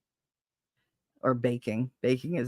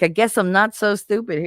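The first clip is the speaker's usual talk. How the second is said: higher, louder mock-triumphant retort; 7 semitones higher, 6 dB louder than usual. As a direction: rub his nose in it.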